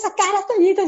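high, loud mock whine; mocking toddler. Mom mocking her daughter's demand, high bratty whine. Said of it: "Y yo".

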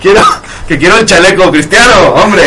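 put-on Spain accent, insistent; mock Castilian. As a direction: ham up a Castilian dub voice. Continues Spanish dub parody.

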